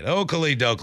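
usual speaking voice

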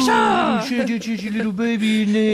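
high creepy cooing to a bump; creepy baby talk. Retold or imitated. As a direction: coo at the belly like a creep. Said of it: "creepy voice too".

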